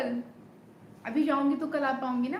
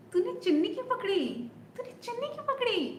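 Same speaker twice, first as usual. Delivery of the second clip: much higher, playful mock indignation; heroine's shy accusation; 10 semitones higher, faster than usual. Heroine asking the soldier who held her scarf, high playful. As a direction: toss the question up high and teasing. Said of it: "तो फिर वो देखती है".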